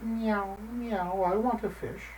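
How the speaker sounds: cat mewing for a fish. Acted. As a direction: mew it like a cat. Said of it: "and mewed".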